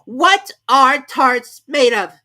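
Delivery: loud, slow, high put-on interrogation; slow, loud pompous question; king's mock deep voice. A narrator's reading. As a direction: act grave and important. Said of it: "he said in a deep voice".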